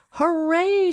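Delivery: high, loud excited shout; child cheering at the top of her voice; joyful shout. A narrator's reading. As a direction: shout it with pure glee. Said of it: "shouted Mimi".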